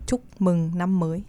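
heavier Northern Vietnamese accent; Northern Vietnamese, firmly pronounced. Shown as a demonstration. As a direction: demonstrate the greeting with a heavy Northern Vietnamese accent. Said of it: "it's heavier on the accent".

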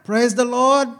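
put-on high, loud church-lady accent, stretched out; Angela's accent again. Pastor retrying Angela's greeting for the congregation, loud accented imitation. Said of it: "I wish I could do that accent".

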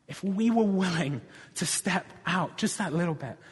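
rousing preacher in full flow; impassioned preaching. Real, not acted. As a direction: drive each promise home to the congregation. Preaches to a climax.

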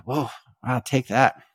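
ordinary talk

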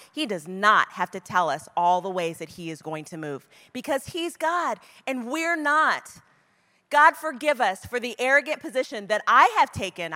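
usual speaking voice

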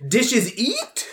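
mock broken English. Shown as a demonstration. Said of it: "I'll be like".

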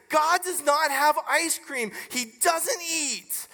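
mock-indignant kid digging in; retold indignant son. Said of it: "he clenches his hands".

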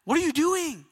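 high-pitched, bewildered appeal; pleading question. Acted. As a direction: demand an answer from God in dismay. Believer pleading with God.

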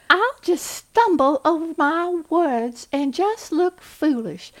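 hammed-up Southern belle accent; Scarlett O'Hara. Shown as a demonstration. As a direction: flutter it like a helpless Southern belle. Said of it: "I love Scarlett O'Hara".

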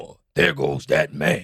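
low, slow commentator impression; deep, drawn-out announcer call; Mark Jackson catchphrase. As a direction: draw it out deep like a broadcast catchphrase. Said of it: "he had great catchphrases too".

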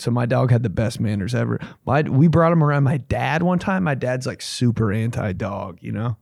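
usual speaking voice

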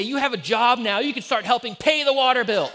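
yelling at son. Retold or imitated. Father yelling at his son through the bathroom door. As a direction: bark it like an angry dad. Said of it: "I sounded so much like my dad".

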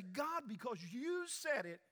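a hint of rising faith-filled resolve; faith-filled declaration. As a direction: proclaim it with eager trust. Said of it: "filled with faith and say".

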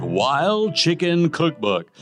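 usual speaking voice

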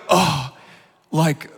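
usual speaking voice

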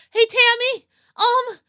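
high, hesitant confiding; high character voice. Acted. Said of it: "I think she's like".

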